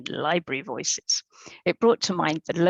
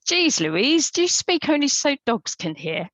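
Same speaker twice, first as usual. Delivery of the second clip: raised, louder, higher-pitched outburst; exasperated shout; 8 semitones higher, 5 dB louder than usual. A narrator's reading. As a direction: read it as a shout into the phone. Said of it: "shouted into my phone".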